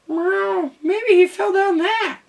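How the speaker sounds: very high, meowing wail; wailing cat. A narrator's reading. Cat at the cliff edge, wailing. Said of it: "wailed the cat".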